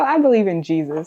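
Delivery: ordinary talk, nothing marked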